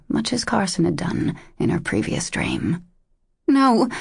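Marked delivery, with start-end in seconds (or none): 3.5-3.9 loud, high desperate cry; cried out. A narrator's reading. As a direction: cry out to stop him leaving. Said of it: "she cried".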